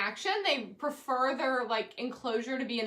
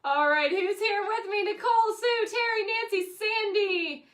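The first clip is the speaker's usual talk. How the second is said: excited roll call; 9 semitones higher, 4 dB louder than usual. Real, not acted.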